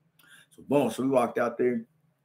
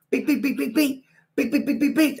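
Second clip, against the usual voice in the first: higher beep punch noises; punch sounds; 11 semitones higher than usual. Retold or imitated. Fighting the Mexican again, punch noises. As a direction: rattle off punches.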